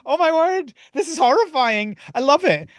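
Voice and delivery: high, excited squeal of horror and glee; hammed-up shock, voice way up; mock horrified delight. Watching Ditto turn human in the trailer, high thrilled mock horror.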